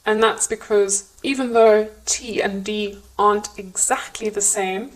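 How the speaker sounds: ordinary talk, nothing marked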